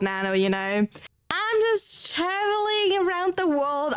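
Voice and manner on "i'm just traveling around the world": higher, slower, a dreamy hammed-up drawl; mock-grand traveller. Joking to chat that she travels the world, mock-dreamy. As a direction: play the mysterious globetrotter, tongue in cheek. Repeats the joke line, hammed up.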